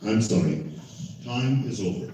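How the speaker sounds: usual speaking voice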